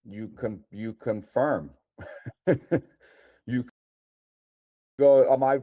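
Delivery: ordinary talk, nothing marked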